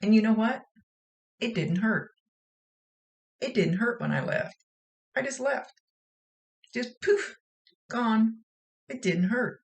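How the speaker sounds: put-on high-pitched reassuring child; spirit girl's voice. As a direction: relay it in a high little-girl lilt. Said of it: "And she says".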